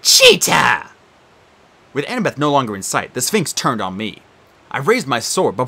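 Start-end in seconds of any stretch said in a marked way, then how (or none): 0.0-0.9 whiny shout. Acted. Sphinx wails.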